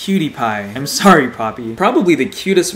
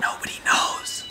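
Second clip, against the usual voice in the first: conspiratorial whisper; 7 dB quieter than usual. Retold or imitated. Voicing designers' secret.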